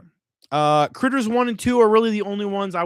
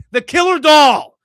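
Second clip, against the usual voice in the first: ordinary talk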